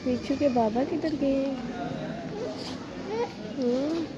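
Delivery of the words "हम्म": ordinary talk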